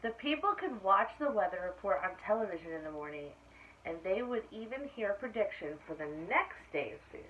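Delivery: clear, lively storybook reading; reading to children. A narrator's reading. Teacher reading aloud to her class, storybook voice. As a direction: read it out to the class like story time. Reads picture book to class.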